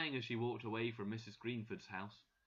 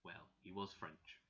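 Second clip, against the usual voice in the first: plain reading voice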